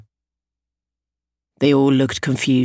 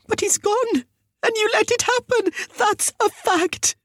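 very high, distraught voice; anguished accusation. A narrator's reading. Mother blaming the teachers for her son, distraught. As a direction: wail it, slowing on the last words.

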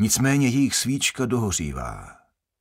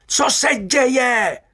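high, slow, louder squeal of panic; shrill screech; 13 semitones higher, 5 dB louder, much slower than usual. A narrator's reading. Delacroix squealing in panic at the guard, screeching. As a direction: screech it like a frightened little Frenchman. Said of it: "zaječel Delacroix".

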